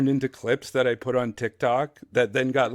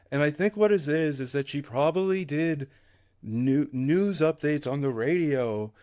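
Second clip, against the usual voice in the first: normal talking voice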